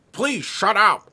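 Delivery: loud, high mock exasperation; imitated pissed off. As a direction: play the roommate at his wits' end. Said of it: "he gets so pissed off".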